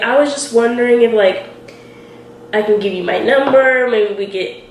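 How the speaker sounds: normal talking voice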